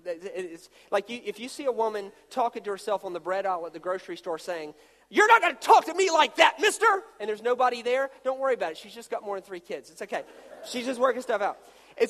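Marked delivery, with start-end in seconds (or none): 5.1-6.8 loud, high scolding of an absent mister; mock scolding mom. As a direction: play a frazzled mom telling off thin air. Woman scolding imaginary person.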